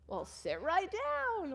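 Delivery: high, slow, sly invitation; mock kid salesman, high and slow; mock student offer. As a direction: lure her into the chair, drawn out.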